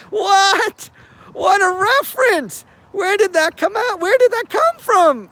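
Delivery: real astonished what; amazed disbelief. Real, not acted. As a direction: squeal with disbelief at the reference. Reacting amazed to the clip.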